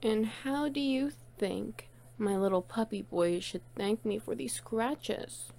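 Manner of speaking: ordinary talk, nothing marked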